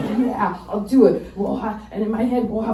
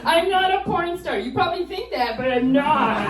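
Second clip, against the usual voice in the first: defensive high; 7 semitones higher than usual. Retold or imitated. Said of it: "nervous small talk".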